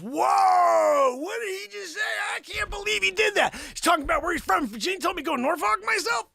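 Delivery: high-pitched scandalized outburst; outraged shock. Acted. Acted shocked offended bystander.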